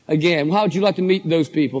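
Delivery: ordinary talk, nothing marked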